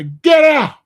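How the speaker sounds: sudden loud shout; shouted order. Acted. Official ordering immigrants out, shouted. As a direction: bellow it like an official throwing people out. Acting out officials deporting people.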